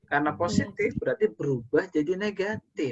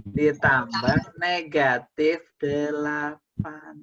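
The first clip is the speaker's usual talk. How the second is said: "negatif" said very slowly and stressed; slow emphasis; much slower than usual. Shown as a demonstration. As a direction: drag out "Ditambah negatif delapan". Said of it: "teacher demonstrates the correct form".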